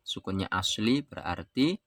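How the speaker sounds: usual speaking voice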